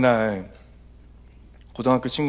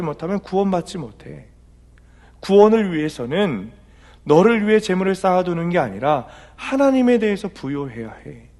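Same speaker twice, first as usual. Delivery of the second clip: higher, louder preaching voice; forceful preaching; 8 semitones higher, 7 dB louder than usual. Acted. Pastor voices the Lord rebuking the rich fool, preaching loudly. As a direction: press the rebuke home from the pulpit.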